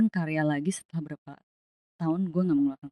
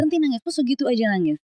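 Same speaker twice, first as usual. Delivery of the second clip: high, louder imitation of a scolding parent; mock parental scolding; 8 semitones higher, 4 dB louder, faster than usual. Parent tells a crying child off, imitated high scolding. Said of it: "kalau nangis dimarahin orang tua".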